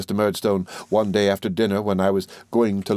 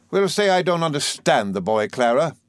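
huffy Miss Murdstone; 7 semitones higher than usual. A narrator's reading.

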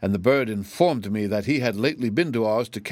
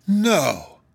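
high exclamation, falling away sharply; astonished cry; 10 semitones higher, 3 dB louder than usual. A narrator's reading. King learning of the Growlywogs' promise, astonished. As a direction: cry out in disbelief. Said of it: "cried the astonished King".

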